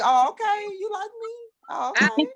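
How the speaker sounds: high, lilting mock surprise; playfully flattered, voice jumping up. Acting flattered by jealousy.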